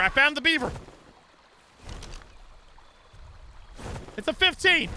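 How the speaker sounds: excited. Real, not acted. Excited find in game.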